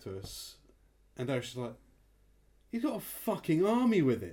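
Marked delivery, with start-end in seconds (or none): 2.7-4.3 retold astonishment, pitched high and loud; incredulous amazement. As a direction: react in loud, amused disbelief. Retold astonished reaction, amused teller.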